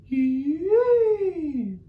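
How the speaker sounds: high, sung hoo like Mama Owl; high head voice. Shown as a demonstration. Teacher models Mama Owl's singing voice, high. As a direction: float it up high and clean. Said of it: "a beautiful singing voice".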